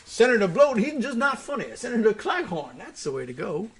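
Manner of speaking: high, swooping put-on voice; mock showbiz verdict. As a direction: act it high, dropping away at the end. Imagined verdict in exaggerated Southern voice.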